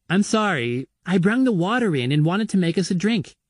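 put-on a hurt kid trying to explain herself; lightly voiced young girl; young girl, apologetic. A narrator's reading. Girl's voice, apologising.

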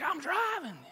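put-on child's voice. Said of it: "He's like".

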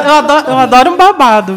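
laughing loudly through it. Real, not acted. To friends, confessing she loves gossip, laughing loudly. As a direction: laugh out the words, delighted.

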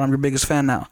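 normal talking voice

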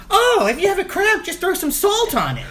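very high, eager mock voice; high-pitched helpful impression; imitated helpful relative. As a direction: pipe up high with silly advice. Voices Gracie offering a silly remedy.

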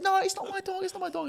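very high-pitched skit character; mock protesting denial. Character denies the dog is hers, mock protesting. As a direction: protest it in a squeaky put-on voice.

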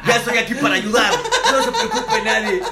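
hammed-up hero dad. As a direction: play the savior dad, overdoing it. Actúa al papá heroico. (papá heroico exagerado, agudo y lento)

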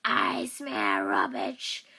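very deep, slow growl of a brute; deep slow Ash voice; Ash impression. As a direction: drag it out low and heavy like Ash. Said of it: "Ja, das ist Ash".

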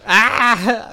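high, loud fake cry of horror; comic horrified shriek; mock scream. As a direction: yelp in hammed-up shock. Victim's mock horror in improv.

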